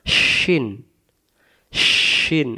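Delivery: "শিন" with a strong hushing sh; sh from mid-tongue. Shown as a demonstration. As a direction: say "শিন" with the sh made from the middle of the tongue. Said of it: "জিহ্বাটার মাঝখান থেকে ভেসে আসবে". ("শিন" জিহ্বার মাঝখান থেকে শ উচ্চারণে)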